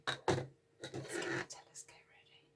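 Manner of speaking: unvoiced hush before the story; whisper. Real, not acted. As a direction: breathe it as a secret. Whispered aside to audience.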